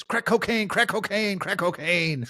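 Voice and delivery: high-pitched repetitive chant; ranting high man. Retold or imitated. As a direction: imitate a wild street ranter chanting. Man high on drugs pounding truck.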